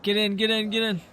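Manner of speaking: very high, urgent get in; frantic urging. Real, not acted. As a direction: hurry them in, voice soaring. Urging teammate into vehicle.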